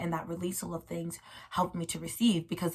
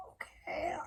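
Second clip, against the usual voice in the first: a tiny whispered, higher okay; whispered, close to a cry; meek whisper; 11 semitones higher, 10 dB quieter than usual. Retold or imitated. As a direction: give in with a small whispered breath. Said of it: "And I'm like".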